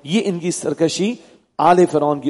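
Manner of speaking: ordinary talk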